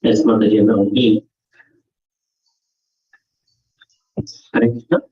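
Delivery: slightly muffled, as through a hand; speaking with the mouth partly covered. Real, not acted. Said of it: "Yes, slightly muffled voice".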